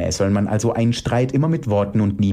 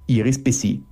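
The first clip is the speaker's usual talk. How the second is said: ordinary delivery, nothing marked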